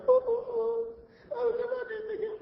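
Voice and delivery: high, wailing fake sobs, rushed; mock crying wife. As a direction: ham up a tearful promise, fast and high. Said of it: "Or she starts crying".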